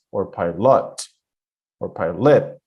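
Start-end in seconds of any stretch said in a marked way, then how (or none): 0.3-1.0 piLOT, second syllable stressed with a full "lut" vowel; wrong stress. Shown as a demonstration. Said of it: "Sounds weird". 1.9-2.6 piLOT, second syllable stressed as "LIT"; wrong stress. Shown as a demonstration. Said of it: "Sounds weird".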